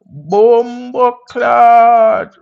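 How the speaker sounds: hammed-up Jamaican greeting, high and loud; Jamaican accent. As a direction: stretch Bumbo Clot out in a thick Jamaican accent for the crowd. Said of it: "I just said Bumbo Clot because people like the accent".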